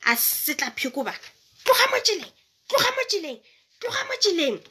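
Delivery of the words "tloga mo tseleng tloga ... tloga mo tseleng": high, angry yell. Acted. Man whipping the fox yells it off the road. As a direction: chase it away in fury. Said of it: "o a galetša".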